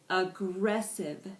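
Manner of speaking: "Aggressive" said slowly, syllable by syllable; slowed-down pronunciation. Shown as a demonstration. Teacher modelling "Aggressive" for the class, slowed down by syllable. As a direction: draw out each syllable of "Aggressive". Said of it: "with varying degrees of speed".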